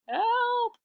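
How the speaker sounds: high shouted help; cry for help. Acted. Reader shows the picture, Iktomi cries out loud and high. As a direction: yelp it out like a trapped man.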